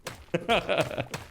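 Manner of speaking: brief high chuckle to himself; a quick amused laugh; short chuckle. Real, not acted. Streamer after his opening line, short chuckle.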